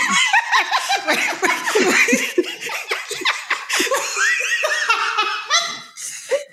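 shrieking helpless laughter; cracking up at her own story; long high laugh. Real, not acted. Guest laughs at the robbers escorting her friend, shrieking laugh.

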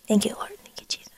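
soft unvoiced whisper of gratitude; whispered thanks. Real, not acted. Whispering thanks to the Spirit, overcome. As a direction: breathe the thanks out almost silently.

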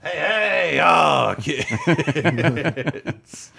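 high shouted clown TV-host greeting; Krusty the Clown. Retold or imitated. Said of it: "Krusty the Clown being his local clown cartoon host".

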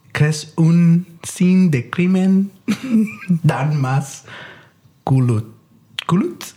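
slow, halting fake French; bad French accent, performed on request; French accent attempt. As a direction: stumble through it in French accent. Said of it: "let's hear it".

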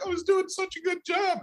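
high, gushing mock praise. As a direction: squeal it like a gushing fan. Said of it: "people are like".

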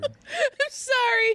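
high, drawn-out apology through laughter; real laughing fit; helpless laughter. Real, not acted. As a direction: squeal it out while cracking up. Apologizing while cracking up at the story.

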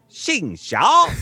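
XING XIAO jumping high out of the narration; exaggerated tones on XING XIAO; copying the recording. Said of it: "do it like the M P three".

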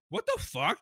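a high startled outburst; mock stunned reaction. Fan retelling his shock at the Smash reveal, high and disbelieving. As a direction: pitch jumps up in disbelief.

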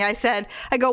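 usual speaking voice

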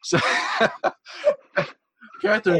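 ordinary delivery

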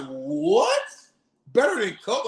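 very high shocked question; incredulous disbelief. Retold or imitated. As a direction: act stunned and offended. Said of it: "I'm like".

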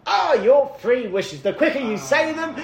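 high, slow, booming grand genie; grandly granting a wish, theatrical; cartoon genie voice. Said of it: "I wish he'd stop talking like that".

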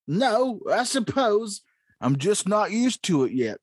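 put-on high, slow, crackly old prospector voice. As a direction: play Gordon as a grizzled old prospector. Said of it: "the part of Gordon will be played by the old prospector".

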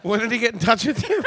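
mock worried mom. Mimicking a mother's high question.

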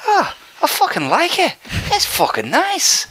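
high pleased Irish voice, slowing at the end; happy Irish fan approving, in an Irish accent. Retold or imitated. Irish fan warming to the jersey, high Irish accent. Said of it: "He goes".

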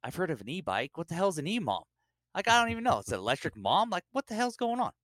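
high-pitched confused protest; comic bafflement. Acted. Newcomer hearing E mom first time, comically baffled. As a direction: climb high on the baffled questions.

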